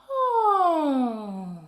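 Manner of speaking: long falling sigh, loud. Shown as a demonstration. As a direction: let out a big audible sigh. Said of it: "sigh it out".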